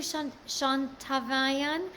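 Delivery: slow, halting go at a name; careful name attempt. Real, not acted. Host thanks viewer with a hard name, careful attempt. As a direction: sound out an unfamiliar name slowly. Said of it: "I hope I said it right".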